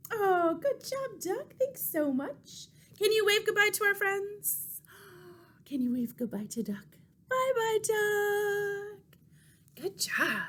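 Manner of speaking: gentle thanks to the puppet. Acted. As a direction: coo at the puppet. Praising the duck puppet.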